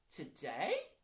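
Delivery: loud, high rising disbelief; incredulous, pitch shooting up; startled question. A narrator's reading. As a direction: react as if caught off guard. Dennis reacts to doing it today.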